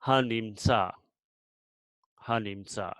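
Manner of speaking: "hanimtsa" ending on a pure open vowel; silent final aleph. Shown as a demonstration. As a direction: let "hanimtsa" end in "ah", no consonant. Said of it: "same way".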